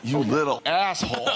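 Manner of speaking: normal talking voice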